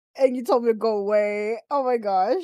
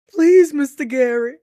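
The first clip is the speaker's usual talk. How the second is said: pleading porn voice; 7 semitones higher, 5 dB louder than usual. Pretend. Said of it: "Oh, I love that one".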